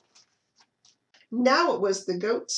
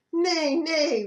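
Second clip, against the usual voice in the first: higher, louder horse whinny; 7 semitones higher, 4 dB louder than usual. Acted. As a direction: neigh the words like a horse. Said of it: "whinnied the horse".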